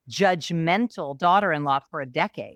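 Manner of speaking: ordinary talk, nothing marked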